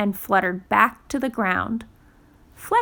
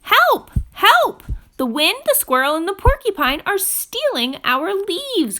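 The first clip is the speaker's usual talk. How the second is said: very high, loud cry for help; cried for help; 9 semitones higher, 6 dB louder than usual. Acted. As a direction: shout it out in alarm. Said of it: "cried Fletcher".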